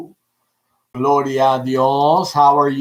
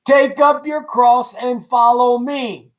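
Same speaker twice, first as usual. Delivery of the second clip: slow, solemn, higher Jesus quote; quoting Jesus; 8 semitones higher, 3 dB louder, slower than usual. Retold or imitated. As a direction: lay out the call slowly and gravely. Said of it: "He said it like this".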